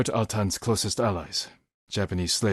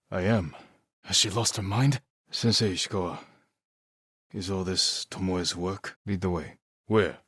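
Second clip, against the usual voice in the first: usual speaking voice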